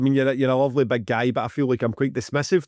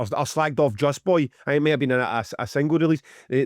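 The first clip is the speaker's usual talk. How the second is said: ordinary talk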